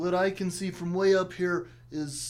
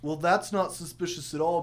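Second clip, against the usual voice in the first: ordinary talk, nothing marked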